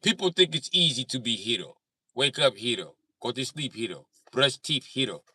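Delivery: imitated Serbian-accented movie speech. Retelling a Deadpool movie speech, imitated Serbian accent. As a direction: deliver it with a put-on Serbian accent. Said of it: "I love that Serbian accent".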